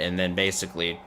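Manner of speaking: ordinary delivery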